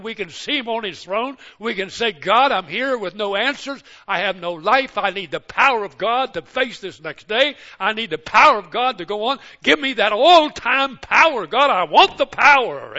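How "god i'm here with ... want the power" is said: louder, higher, desperate plea; pleading cry to God. Acted. The preacher cries out to God for strength, loud and desperate. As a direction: beg God for power with everything.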